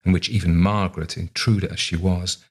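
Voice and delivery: ordinary delivery, nothing marked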